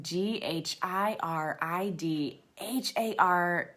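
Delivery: slow, careful letter-by-letter spelling; spelling aloud. Shown as a demonstration. Host spelling an author's surname for a search, letter by letter. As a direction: say each letter on its own. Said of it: "let me try to spell it".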